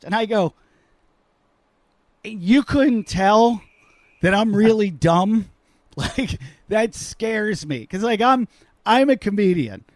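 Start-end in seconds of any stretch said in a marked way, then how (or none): 2.2-5.5 slow, high, louder mock disbelief. Comedian to a cardiologist, slow, high mock disbelief. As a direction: drag it out, stunned.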